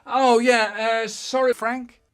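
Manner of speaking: put-on loud, high, caught-out child; sheepish apology. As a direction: blurt it out flustered. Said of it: "And I said".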